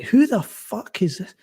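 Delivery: ordinary delivery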